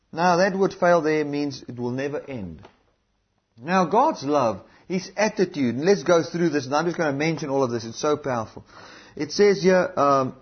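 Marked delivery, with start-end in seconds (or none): none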